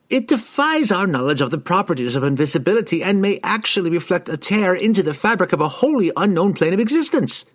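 high, rushing enthusiasm; carried away by his theory; excited wizard. A narrator's reading. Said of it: "his excitement had gone beyond".

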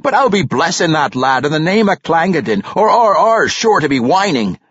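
high, jubilant dwarf voice; joyful cry. A narrator's reading. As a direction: proclaim a hearty celebration. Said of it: "cried Parson Glaive".

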